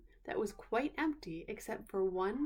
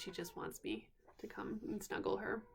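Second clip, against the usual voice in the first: real whisper, softer; 7 dB quieter than usual. Real, not acted. Aside while baby sleeps nearby.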